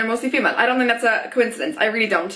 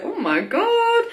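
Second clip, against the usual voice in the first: "Oh my god" much higher, slow and stretched; drawn-out high oh my god; 10 semitones higher, much slower than usual. Retold or imitated. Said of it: "so I was just like".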